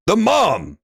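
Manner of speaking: ordinary talk, nothing marked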